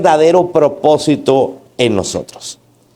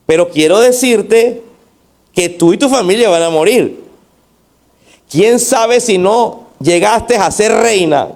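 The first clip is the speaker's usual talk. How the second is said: stern warning; 5 semitones higher than usual. Acted. Acts out Mardoqueo's rebuke to Esther.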